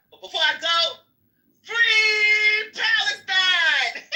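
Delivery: high, drawn-out laughing shout; joking shout. Retold or imitated. Joking with new friends, a drawn-out laughing shout. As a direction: belt it out as a cheeky joke, laughing. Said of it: "and I was just like".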